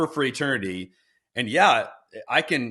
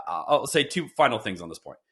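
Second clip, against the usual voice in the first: usual speaking voice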